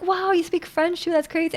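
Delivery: a touch of mock awe, kept small; imitated impressed coworkers. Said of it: "and they're like".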